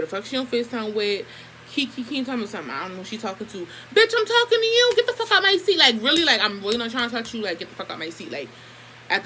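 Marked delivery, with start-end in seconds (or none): 3.6-5.8 high-pitched furious order to move; retold shouted order. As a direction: bark it up high at her. Retold yelling at girl.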